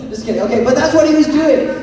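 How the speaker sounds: normal talking voice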